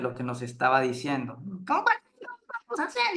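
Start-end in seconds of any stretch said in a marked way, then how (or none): 1.7-3.2 imitating an excessively nasal colleague, very high; exaggerated high nasal mimicry; mock nasal voice. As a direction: put on a grating, high-pitched nasal voice. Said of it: "hablaba de una forma muy nasal".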